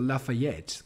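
Lafayette prononcé à l'anglaise; imitating an English speaker saying a French name; accent anglais. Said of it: "comme ça, en, avec leur accent anglais". (imitation d'accent anglais)

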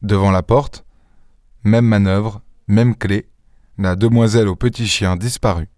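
ordinary delivery, nothing marked

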